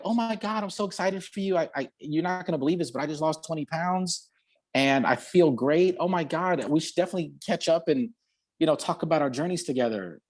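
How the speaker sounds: high, gushing friend, rushing the words; excited gushing. Retold or imitated. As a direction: gush with delight at the news. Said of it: "she was like".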